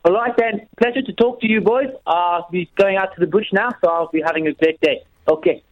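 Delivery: put-on light South African accent; a hint of South African. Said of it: "say goodbye to us in your South African".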